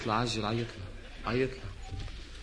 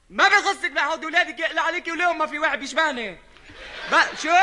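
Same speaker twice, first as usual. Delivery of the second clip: high and loud comic bickering; angry retort; 6 semitones higher, 4 dB louder than usual. Acted. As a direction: snap back at her. Quarrel on phone.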